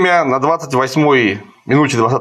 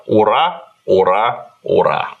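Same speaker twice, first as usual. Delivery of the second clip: mock cheer without any gusto, stepping lower each time; deadpan, sinking hurrah; half-hearted retold cheer; 5 semitones lower, slower than usual. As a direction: recite the battle cry limply. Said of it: "На стенах кричат".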